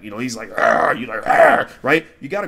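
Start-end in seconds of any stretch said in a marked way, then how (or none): 0.6-0.9 loud mock growl; a short, loud "arr" from a menacing beast. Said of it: "when he growls at you". 1.3-1.7 very loud answering growl; big, forceful mock growl; growling back. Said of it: "you growl back".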